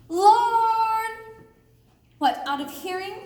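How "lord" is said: a high, loud drawn-out call. Acted. Hermia searching for Lysander, loud drawn-out call. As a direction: call into the woods.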